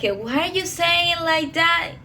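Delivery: questioning classmates. Retold or imitated. Classmates question the speaker's pronunciation, mock puzzlement. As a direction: imitate schoolmates querying an odd word. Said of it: "so they were like".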